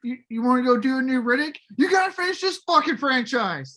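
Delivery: high, heated mock rant; going off. Director going off at Vin, heated mock rant. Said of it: "just starts going off".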